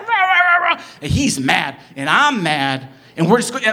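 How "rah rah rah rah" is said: high, loud wordless raging; mock roar of rage; imitated angry screaming. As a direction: mimic a furious driver's garbled yelling. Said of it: "he's screaming at me".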